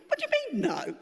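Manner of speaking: can't believe what she just heard; high, incredulous what do you mean; indignant disbelief. Retold or imitated. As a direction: pitch leaps up in outraged surprise. Shocked at being refused same pay.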